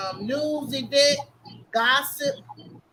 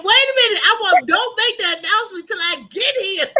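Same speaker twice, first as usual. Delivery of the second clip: higher and louder, halting her; urgent loud interruption; 6 semitones higher, 8 dB louder than usual. Real, not acted. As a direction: jump in to stop the announcement. Stopping the announcement.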